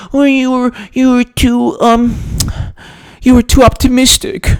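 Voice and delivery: mocking nasal critic voice, high and dragged out. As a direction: mimic the critics high and whiny.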